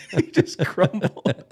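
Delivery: said through real laughter; can't stop laughing while telling it. Real, not acted. Telling a friend about the crumbling marine, laughing through it.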